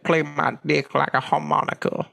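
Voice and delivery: a put-on rock singer voice; a cheesy rocker come-on. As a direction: purr it like a washed-up rock star. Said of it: "I didn't know you had a [name] impression".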